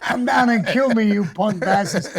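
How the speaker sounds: mocking the newspaper's version of his threat, loud and laughing; mock tough-guy taunt. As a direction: ham up the tabloid version of the threat. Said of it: "I didn't use the word punk".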